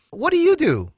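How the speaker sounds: a light mock of an eager stranger asking Gary; stranger asking, mock high voice. Stranger at Pearl Harbor asking Gary, high mock curiosity. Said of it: "he looked at Gary and he said".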